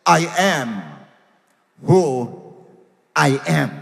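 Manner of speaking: slow, solemn divine declaration; quoting a divine answer, measured and grave; God. Retold or imitated. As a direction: set each phrase apart with weighty pauses. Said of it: "And God said to Moses".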